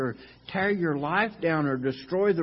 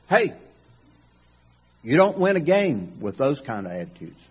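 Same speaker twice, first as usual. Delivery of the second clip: ordinary delivery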